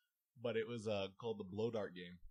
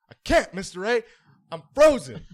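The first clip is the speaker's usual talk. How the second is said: higher, loud pleading whine; loud whiny plea; 11 semitones higher, 19 dB louder than usual. Retold or imitated. Student refusing to move tells teacher, loud whining plea. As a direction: beg the teacher like a stuck kid. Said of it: "He's like".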